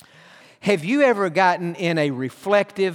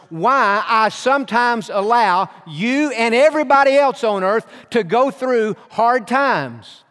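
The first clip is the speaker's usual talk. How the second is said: rising, louder fatherly proclamation; voice of God; 4 semitones higher, 3 dB louder than usual. Retold or imitated. Pastor voices God answering him, building proclamation. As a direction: speak as the sovereign King, building. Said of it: "He said".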